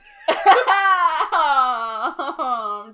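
loud cackle; loud laughing cackle. Real, not acted. Laughing at the hoodies.

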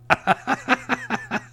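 short, high-pitched laugh; a quick squeaky laugh at his own joke. Real, not acted.

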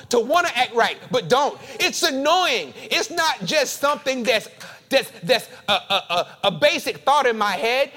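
loud, rising spoken-word sermon; fervent preaching. Acted. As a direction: preach it with building fire.

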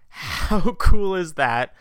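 slow, loud, laughing gush; laughing delight. Real, not acted. Host gushing to listeners about the kits, laughing delight. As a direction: savor every word with a delighted laugh.

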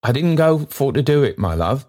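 put-on kindly old man pressing his offer. Trotty offering the stranger lodging, eagerly. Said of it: "hastily repairing his mistake".

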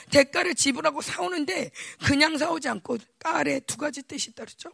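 ordinary talk, nothing marked

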